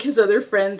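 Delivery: ordinary talk, nothing marked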